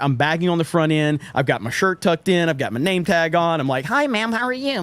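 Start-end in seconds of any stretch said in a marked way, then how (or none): 3.8-4.8 put-on high-pitched, polite young greeter; chirpy imitation of a proud new employee; eager bag boy. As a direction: greet the customer eagerly in a higher voice. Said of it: "taking very much pride in my job".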